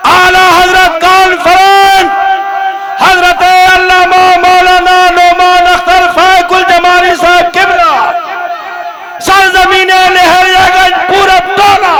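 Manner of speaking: high, loud rally calls; slogan announcer. Acted. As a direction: rouse the crowd with each slogan. (ऊँचे स्वर में जोशीले नारे)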